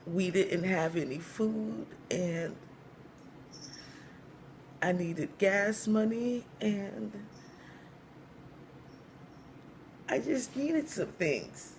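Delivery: high, slow, halting confession; mock tearful. Young wife explaining herself to church mother, halting and mock tearful. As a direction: draw it out reluctantly, long pauses. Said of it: "as she's crying".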